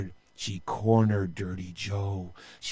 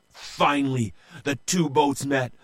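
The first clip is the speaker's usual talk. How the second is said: ordinary delivery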